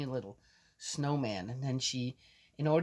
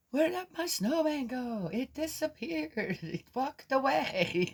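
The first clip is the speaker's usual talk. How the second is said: put-on much higher, childlike puzzled voice; granddaughter's voice; 11 semitones higher than usual. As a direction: play a little girl wondering where her snowman went. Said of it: "she says".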